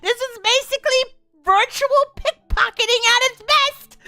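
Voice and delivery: high, loud, mock-outraged rant; loud laughing rant. Real, not acted. Player ranting about the game company, high and loud. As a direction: rail against the game with comic outrage.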